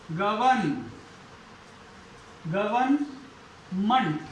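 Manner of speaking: गवर्नमेंट slowly split, "guh-vun ... munt"; careful "guvan-munt". Shown as a demonstration. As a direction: draw out गवर्नमेंट syllable by syllable, R silent. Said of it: "इसका सही उच्चारण क्या है".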